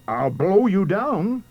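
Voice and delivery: loud, high, drawn-out threat; storybook wolf working up to a blow; threatening wolf. A narrator's reading. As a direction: bellow it slowly as the big bad wolf. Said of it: "the wolf replied".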